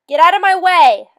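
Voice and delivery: loud frantic shout; yell. Acted. Lost man shouting at a hare blocking the path, loud yell. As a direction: bellow it to clear the path. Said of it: "I yelled at the hare".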